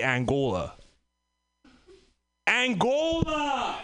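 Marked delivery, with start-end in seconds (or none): 2.5-3.8 incredulous. Real, not acted. Incredulous exclamation.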